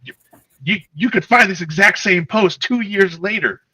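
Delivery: high, loud incredulous retort; exasperated, baffled pushback. Retold or imitated. His retorted reply.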